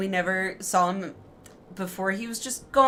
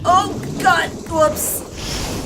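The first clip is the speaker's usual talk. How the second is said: startled yelp; 8 semitones higher, 7 dB louder, much slower than usual. Real, not acted. Sudden scare in game.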